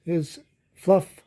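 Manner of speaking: ordinary delivery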